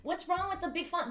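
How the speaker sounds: mock offended. Mock-offended about font size.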